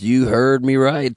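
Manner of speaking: normal talking voice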